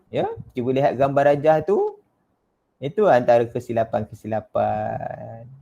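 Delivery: ordinary talk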